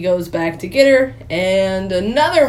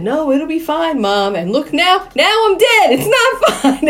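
rising, louder, higher mock panic; panicky whine; 8 semitones higher, 4 dB louder than usual. Kidnapped woman to her captor about her mother's advice, mock panic. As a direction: build into a hammed-up wail, laughing.

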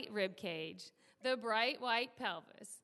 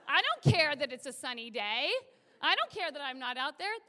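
ordinary talk, nothing marked

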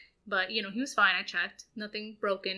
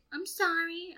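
put-on high, small boy's voice; a light imitation of a guilty child; son's apology; 9 semitones higher, slower than usual. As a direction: sound like a sheepish kid. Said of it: "he's like".

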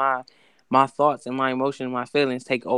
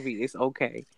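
mild imitation of a calm boyfriend, soft; calm reassurance; 3 semitones lower, 9 dB quieter than usual. Boyfriend calmly forgives her for the broken rim, soft and low. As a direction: softly play him unbothered and reassuring. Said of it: "He said it in a calm tone?".

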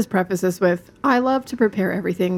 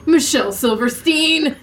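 louder, drawn-out announcer intro; grand announcement; 7 semitones higher, 4 dB louder, slower than usual. Real, not acted. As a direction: present her like a star guest. Announcing the guest.

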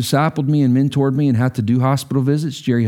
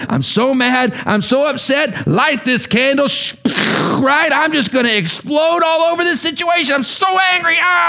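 higher, building into a hammed-up shout; mock angry outburst; 11 semitones higher than usual. Preacher mocking someone venting anger, rising to a mock shout. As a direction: play the hothead ready to explode. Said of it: "where you just go".

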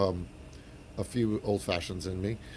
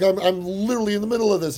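high, fast, eager fan gush; excited fanboy; 10 semitones higher, 6 dB louder, faster than usual. Retold or imitated. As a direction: blurt it out, thrilled. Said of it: "and I'm like".

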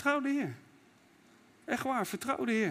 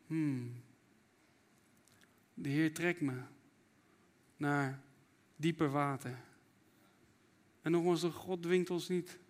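softer, quiet, intimate sermon voice; soft preaching; 5 semitones lower, 6 dB quieter than usual. Acted. Preacher gently inviting the congregation to surrender, softer. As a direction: draw them in gently, slowing at times.

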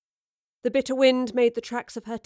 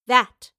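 "That" punched much louder, falling; stern emphasis; 3 semitones higher, 10 dB louder than usual. A narrator's reading. Professor pointing at Bill's body, stressing "That" sternly. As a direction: hit "That" hard and drop it. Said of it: "the professor said sternly".

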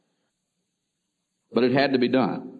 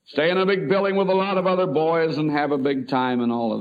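upbeat and higher, talking up a big adventure; cheerful selling it; 7 semitones higher, 3 dB louder than usual. Retold or imitated. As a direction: make it sound like a wonderful treat for the boy. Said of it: "Let's talk about it like it's something wonderful".

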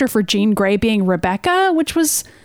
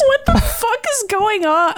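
high, stretched-out disbelief; exasperated outburst; 10 semitones higher, slower than usual. Real, not acted. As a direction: blurt it out, baffled and fed up. Annoyed at the stupid episode.

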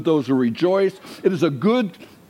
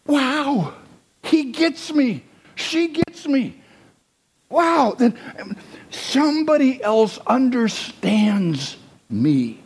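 high, slow, amazed voice; high wondering delight at being understood; relieved listener; 8 semitones higher, slower than usual. Retold or imitated. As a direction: sound like someone finally understood. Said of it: "This idea like".

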